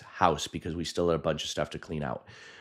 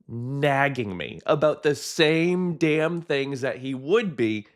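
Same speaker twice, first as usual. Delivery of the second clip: mock nagging; 7 semitones higher, 3 dB louder, slower than usual. Mimicking dad's nagging.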